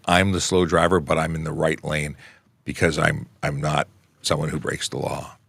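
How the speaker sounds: ordinary delivery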